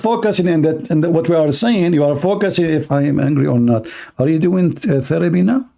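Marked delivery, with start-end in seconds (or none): none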